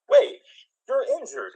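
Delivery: high-pitched startled hesitation; lightly acted alarm at a wounded creature; mock concern. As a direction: catch yourself, suddenly concerned. Acts boy finding hurt dragon.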